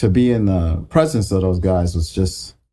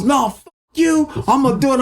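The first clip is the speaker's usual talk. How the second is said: mock angry retort. Producer hitting back in the argument, very high. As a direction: fire it back, stretched out high. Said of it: "He goes".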